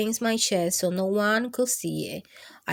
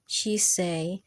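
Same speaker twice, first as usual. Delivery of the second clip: ordinary delivery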